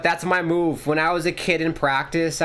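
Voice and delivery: ordinary talk, nothing marked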